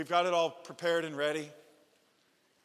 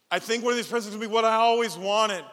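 higher and louder, bursting with hope; excited kid; 5 semitones higher, 8 dB louder than usual. Retold or imitated. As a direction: let the child's excitement burst out.

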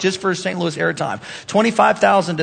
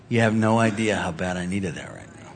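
low heartfelt reply; 12 semitones lower, 9 dB quieter than usual. Acted. Voices a grateful text reply.

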